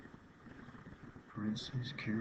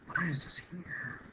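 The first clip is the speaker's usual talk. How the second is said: higher, slow, puzzled whisper; exasperated whisper; 8 semitones higher, slower than usual. Real, not acted. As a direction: whisper it, baffled and annoyed. Annoyed at pop-up.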